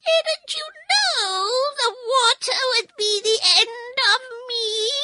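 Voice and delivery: very high wailing witch; wailing despair. Acted. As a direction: wail it in despair. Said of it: "in a wailing, despairing voice".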